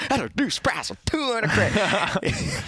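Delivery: high, slow, hammed-up sales pitch; cheesy salesman voice. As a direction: imitate the infomercial host, over the top. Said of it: "Is that your sale?".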